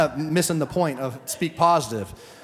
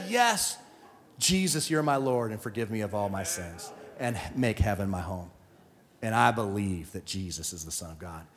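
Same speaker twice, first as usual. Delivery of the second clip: hushed prayer; 7 semitones lower, 7 dB quieter than usual. Retold or imitated. Retold prayer of the thief.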